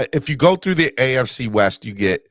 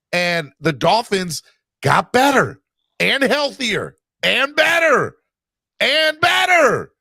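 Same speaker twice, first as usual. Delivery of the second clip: rising higher and slower with each better; building excitement; 9 semitones higher, slower than usual. Real, not acted. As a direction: pile on the hype, climbing. Homer hyping his team.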